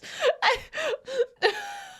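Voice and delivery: shrieking helpless laugh; high wheezing laugh. Real, not acted. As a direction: laugh until it squeaks. Streamer cracks up at chat.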